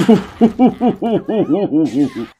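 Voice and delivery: loud laughing cackle; high cackling laugh. Real, not acted. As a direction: cackle with delight. After the punch.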